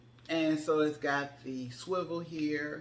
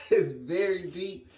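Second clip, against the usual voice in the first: laughing at her own morning voice; 3 semitones higher, 5 dB louder than usual. Real, not acted. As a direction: chuckle through the words. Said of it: "this is my morning voice".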